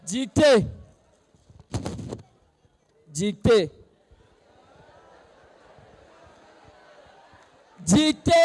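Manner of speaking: stern shouted teacher; strict teacher calling dictation. Pretend. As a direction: bark out the dictation like a feared schoolmaster. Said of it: "Je vais jouer le rôle de mon enseignant". (maître sévère qui annonce la dictée)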